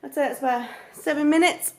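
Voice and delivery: ordinary talk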